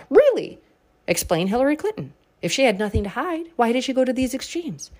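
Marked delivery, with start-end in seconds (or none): 0.1-0.6 high, loud, incredulous Really?; sarcastic incredulous retort; mock disbelief. Real, not acted. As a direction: scoff in disbelief.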